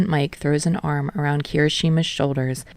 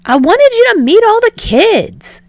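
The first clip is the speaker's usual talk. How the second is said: much higher, loud and bubbly; excited; 9 dB louder than usual. Acted. Yamada introducing the kids to Shota, loud and excited. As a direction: gush like an eager host. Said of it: "Yamada says excitedly".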